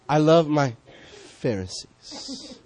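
mock the idea with a slow, sugary drawl; mock sarcastic Jesus. Mock Jesus loving religious folk.

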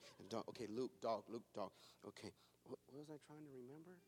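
low, hushed repetition to himself; muttered memorizing. Retold or imitated. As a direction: mumble it over and over like cramming by rote. Acts out muttering memory drill.

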